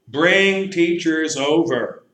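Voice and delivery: slow loud command; insistent order. Retold or imitated. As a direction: lay down the order slowly. Nun's insistent demand quoted.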